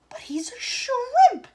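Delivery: high, loud, slow cry; shouted. A narrator's reading. Father shouting at tiny son on screen. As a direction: shout it in dismay. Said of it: "shouted Mister T V".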